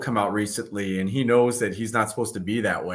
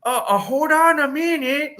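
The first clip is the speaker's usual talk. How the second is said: Master Splinter; 5 dB louder than usual. Retold or imitated. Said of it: "he still did his impression".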